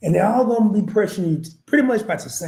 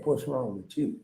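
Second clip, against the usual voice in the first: quiet aside; 5 semitones lower, 9 dB quieter than usual. Retold or imitated. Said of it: "you really didn't ask your mama".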